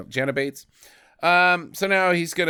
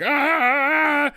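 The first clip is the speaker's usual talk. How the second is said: sudden high yelp of fright; startled yelp; 11 semitones higher than usual. Retold or imitated. Man flinching at the topless lady, high startled yelp. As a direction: jump back with a squeak. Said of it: "And he's like".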